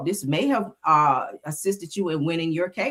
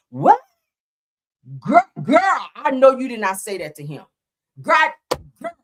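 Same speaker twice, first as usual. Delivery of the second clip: put-on loud, higher, scandalized "girl, girl"; dramatic gossip reading; 4 semitones higher, 6 dB louder than usual. Shown as a demonstration. Showing viewers how juicy texts should be read, loud and scandalized. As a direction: read it like you can't believe she said that. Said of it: "That's how you gotta read them like that".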